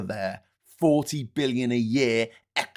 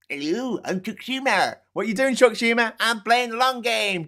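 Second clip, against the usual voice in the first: much higher, silly cartoon voice; mock Chuck Schumer; 11 semitones higher, 4 dB louder than usual. Mocking Chuck Schumer's strategy, high silly voice. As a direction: play him as a clueless goof.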